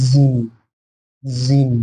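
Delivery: জিম with a thick, full sound. Shown as a demonstration. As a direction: make the sound of জিম heavy. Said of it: "এটা উচ্চারণটা মোটা হবে".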